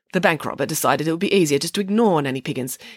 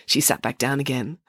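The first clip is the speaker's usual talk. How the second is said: ordinary delivery, nothing marked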